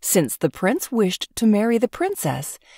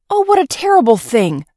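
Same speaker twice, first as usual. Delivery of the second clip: loud, higher cry of disgust; princess cries out; 7 semitones higher, 7 dB louder than usual. A narrator's reading. Princess shrinking from the nightingale, a loud cry. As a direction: cry out in revulsion. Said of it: "the princess cried".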